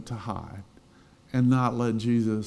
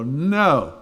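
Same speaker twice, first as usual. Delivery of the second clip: loud, drawn-out no; emphatic refusal; 3 semitones higher, 6 dB louder than usual. Acted. Struggler answering an invitation to joy, emphatic refusal. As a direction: refuse it outright, voice raised and lifted.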